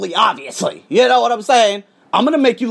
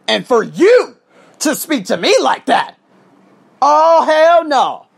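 high, loud outraged refusal; real indignation; 6 semitones higher, 5 dB louder, slower than usual. Real, not acted. Teacher rejecting how colleague spoke to him, outraged. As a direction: build to a loud, drawn-out rejection.

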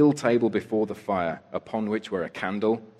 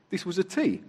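pompous beadle; 10 semitones higher than usual. A narrator's reading. Beadle Bumble speaks pompously.

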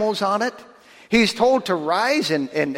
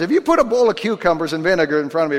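ordinary talk

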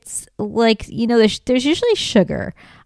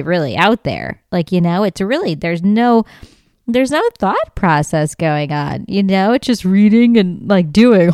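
ordinary talk

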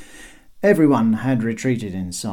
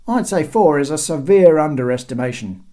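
raised, loud pedantic quibble; voice from above; 5 semitones higher, 7 dB louder than usual. A narrator's reading. Second guard correcting the herald, voice from above. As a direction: call the correction down. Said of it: "said a voice from above".

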